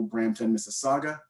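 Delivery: ordinary talk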